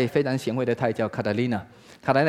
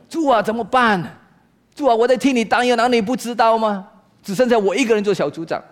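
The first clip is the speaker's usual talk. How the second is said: high-pitched, exasperated cry to the Lord; hammed-up anguished prayer; desperate plea; 9 semitones higher, 3 dB louder than usual. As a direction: complain upward to God in mock desperation. Acted desperate prayer of group leader.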